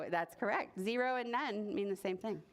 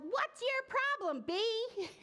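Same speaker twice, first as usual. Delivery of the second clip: storybook yell; 12 semitones higher, slower than usual. A narrator's reading. Boy fed up with a silent bee, mock yell. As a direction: pitch it way up in exasperation. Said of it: "threw his hands in the air and yelled".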